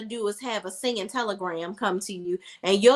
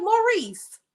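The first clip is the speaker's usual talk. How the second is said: exasperated call; 12 semitones higher than usual. Real, not acted. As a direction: yell at the screen in disbelief. Calling out at the character.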